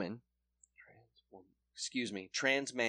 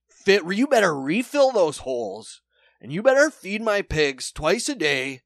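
higher, much louder commanding order; bossy loud demand; 5 semitones higher, 9 dB louder than usual. Retold or imitated. Arrested pig farmer ordering police around, loud and bossy. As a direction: bark orders at the cops from handcuffs.

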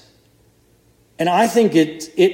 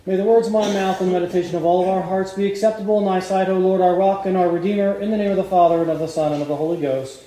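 softer, fast prayer fading to amen; closing prayer; 4 dB quieter, faster than usual. Acted. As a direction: pray it as a quiet liturgical close.

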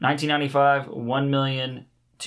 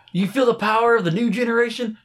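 mock announcer; 9 semitones higher than usual.

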